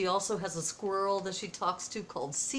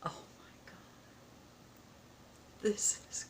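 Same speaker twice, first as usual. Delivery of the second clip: awed whisper; 10 dB quieter, faster than usual. Real, not acted. Awed reaction to the gift.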